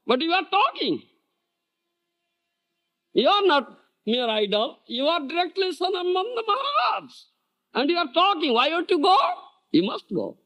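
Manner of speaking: high, insistent chiding voice; scolding the deity. Retold or imitated. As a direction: press the idol to get up and go.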